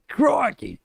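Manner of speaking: high-pitched put-on crikey; mock exclamation. Non-Australians echoing the Australian, mocking high exclamation. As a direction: squawk it like an overdone impression. Imitating people hearing an Australian.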